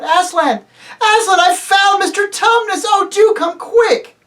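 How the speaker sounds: put-on very high, loud girl's cries; excited shouting. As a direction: shout urgently as you rush upstairs. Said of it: "Lucy came rushing upstairs shouting out".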